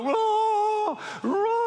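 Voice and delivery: high hammed-up wailing cry; mock wailing. Hired funeral wailer hamming up a high wail. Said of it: "professional wailers ... go".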